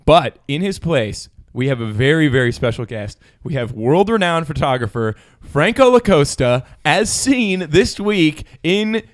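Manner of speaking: announcer introduction. Acted. Host unveils a celebrity guest to listeners, hyped announcer build-up.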